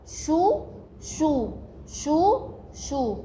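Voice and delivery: each "شو" slow and separate; teacher breaking "شو، شو" apart with pauses; split into syllables. Shown as a demonstration. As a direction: sound out "شو، شو" one drawn-out syllable at a time. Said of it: "تعالوا نقسمها سوا".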